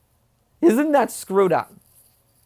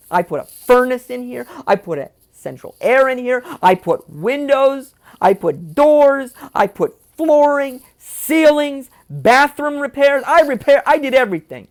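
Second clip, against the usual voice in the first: louder, slow, hammering each item; angry listing; 4 semitones higher, 5 dB louder than usual. Real, not acted. Son ranting to viewers about his father, louder and hammering. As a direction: pound out every repair you paid for.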